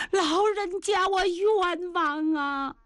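put-on a girl's high, drawn-out wail, slowed right down; crying out wronged. A narrator's reading. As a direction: sob out her grievance to the old man, stretching each word. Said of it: "这姑娘一边哭一边说呀". (姑娘向老人家哭诉冤屈，拖长哭腔)

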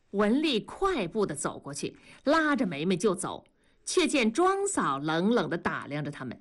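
plain reading voice

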